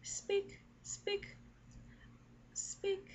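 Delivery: short high sung notes; high pitch. Shown as a demonstration. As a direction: pitch each word way up high. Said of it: "if I go up, if in, in pitch".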